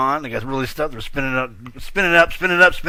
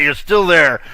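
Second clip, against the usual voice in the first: ordinary talk, nothing marked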